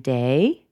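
"day" swept upward; unfinished, rising "day"; rising intonation. Shown as a demonstration. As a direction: lift "day" as if continuing. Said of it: "she went up in intonation".

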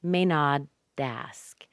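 slow, drawn-out "may not ask"; teacher's slow-motion "may not ask"; slow linked demo. Shown as a demonstration. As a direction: stretch "not" into "ask", t softened to a d. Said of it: "The T sounds like a D there".

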